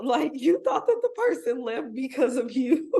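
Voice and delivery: real laughter breaking into the words; laughing disbelief. Real, not acted. Host reacts to actor's cringiest moment, laughing in disbelief.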